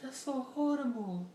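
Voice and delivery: shocked woman, then sales pitch. Retold or imitated. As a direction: drag out the fake sympathy, then brightly pitch the lotion. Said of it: "she was like".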